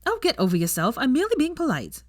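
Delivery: sharp irritable retort, rising high; snapping. A narrator's reading. Young woman snaps at a man shaking her hand, annoyed. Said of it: "she snapped".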